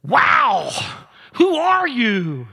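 high, loud, amazed cries; a drawn-out, wondering "Who are you?"; astonished disciples. Retold or imitated. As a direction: gasp it out as stunned followers. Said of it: "they probably go".

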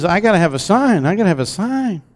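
lightly voiced Gideon begging for proof; pleading Gideon. Gideon pressing the angel for a sign, mildly pleading. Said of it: "he says".

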